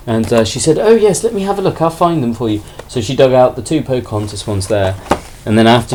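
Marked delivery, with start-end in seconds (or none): 0.8-2.6 eagerly helpful. Retold or imitated. Seller voiced obliging the polite customer.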